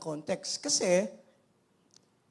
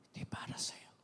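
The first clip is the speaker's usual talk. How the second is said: unvoiced, much softer whisper; 13 dB quieter than usual. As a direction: breathe the refusal gently. Jesus's refusal voiced as whisper. (pabulong na pagtanggi)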